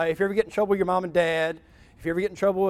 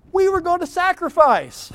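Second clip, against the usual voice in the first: much higher pleading excuse; whiny excuse; 12 semitones higher, 5 dB louder than usual. Acted. Saul defends himself to Samuel, high whiny excuse.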